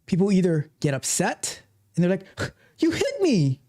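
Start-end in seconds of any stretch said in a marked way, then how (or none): 2.8-3.6 high, whining complaint; a high, wounded whine; whiny cry. Retold or imitated. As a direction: play the hurt crybaby. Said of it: "they say it just like that too".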